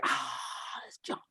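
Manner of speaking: hushed, breathless whisper; awed whisper. Retold or imitated. Singers spotting John on the stairs, awed whisper. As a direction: whisper it like a star just walked in.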